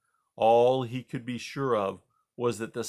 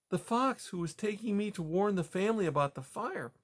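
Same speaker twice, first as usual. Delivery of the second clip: put-on higher, softer old mouse; puzzled but kind; gentle grandma mouse; 9 semitones higher, 3 dB quieter than usual. Grandma Poppy.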